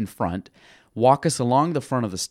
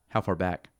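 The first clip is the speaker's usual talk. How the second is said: mildly deadpan, low and quiet; straight face; 5 semitones lower, 6 dB quieter than usual. Retold or imitated. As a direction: keep a poker face and ask it flat out. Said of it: "So I'm a straight face".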